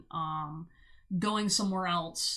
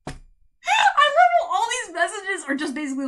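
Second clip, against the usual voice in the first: laughing; 9 semitones higher, 9 dB louder than usual. Real, not acted. Reader amused by the partner's repeated message, talking through laughter. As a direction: crack up while talking.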